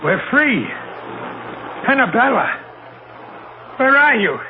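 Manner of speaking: calling out. Acted. Captain calls for his dead wife, rising desperate calls. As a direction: call her name into the dark, pleading.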